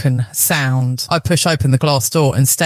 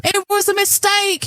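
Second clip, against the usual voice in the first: high tearful cry. A narrator's reading. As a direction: plead through tears. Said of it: "he cries".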